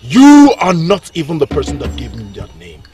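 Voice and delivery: loud, low defiance; bitter, loud rebuttal; defiant retort. Acted. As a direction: throw it back at the king. Prince defies the king.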